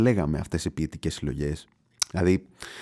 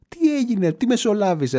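higher, pushing demand for an answer; exasperated; 11 semitones higher, 4 dB louder than usual. Retold or imitated. Customer demands an explanation from the clerk, higher and exasperated. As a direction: press the clerk in disbelief.

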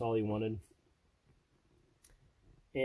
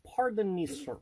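higher, mock Russian-accented politeness; Mishka Mishka voice; 5 semitones higher than usual. To a store clerk, in a mock Russian accent. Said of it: "Mishka Mishka is a mixture of".

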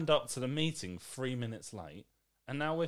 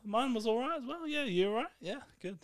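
high, chirpy parody of a chatty greeting; an office colleague parroting small talk; mock British small talk; 10 semitones higher than usual. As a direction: rattle off empty pleasantries in a sing-song. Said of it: "here's how the conversation goes".

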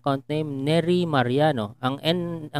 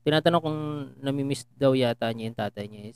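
usual speaking voice

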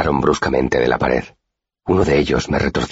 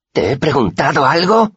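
high furious bully shout; angry shout; 13 semitones higher, 3 dB louder than usual. A narrator's reading. Pike yelling at the boy he holds by the shoulders, high and furious. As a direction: bark the question at him in rage. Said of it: "gritó".

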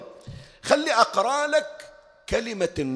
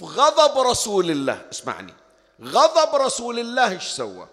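preaching; 6 semitones higher, 7 dB louder than usual. Acted. Preacher stresses the Prophet's anger.

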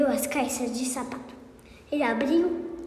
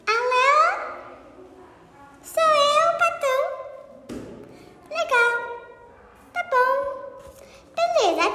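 phone call; 13 semitones higher than usual. Acted. Patão phones the police.

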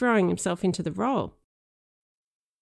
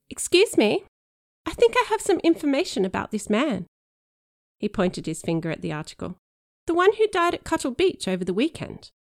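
put-on higher, eager boy reporting to police; earnest informant; 7 semitones higher than usual. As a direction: play the keen witness. Danny plays informant.

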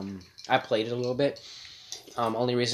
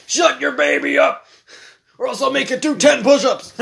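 drill sergeant; 12 semitones higher, 9 dB louder than usual. Pretend. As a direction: bellow like a drill instructor. Colonel yelling at mom.

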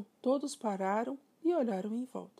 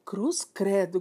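louder startled exclamation; crying out; 7 dB louder, slower than usual. Acted. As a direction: gasp it out in fright. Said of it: "gritou a mãe".

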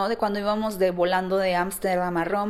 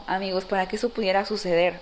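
ordinary delivery

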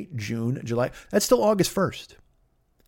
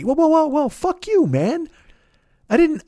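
outraged yell; 10 semitones higher than usual. Real, not acted. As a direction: blow up in disbelief. Outraged refusal to charge a gunman.

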